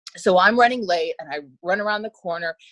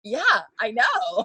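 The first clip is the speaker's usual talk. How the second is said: laughing reply; 4 semitones higher, much slower than usual. Retold or imitated. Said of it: "And I said".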